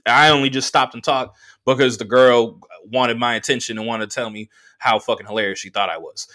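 ordinary talk

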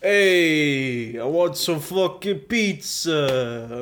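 slow, stretched Italian American; loud cartoon Italian American; Italian American accent. Cohost demanding pizza, hammed-up Italian American accent. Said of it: "Italian American".

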